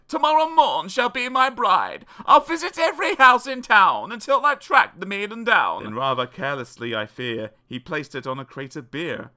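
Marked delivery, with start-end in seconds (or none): 0.1-5.8 loud, high princely vow; raised, hammed-up declaration; crying out. A narrator's reading. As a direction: proclaim it like a melodramatic prince. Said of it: "he cried".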